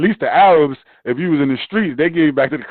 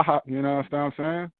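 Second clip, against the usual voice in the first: normal talking voice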